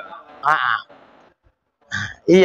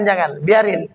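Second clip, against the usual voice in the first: ordinary delivery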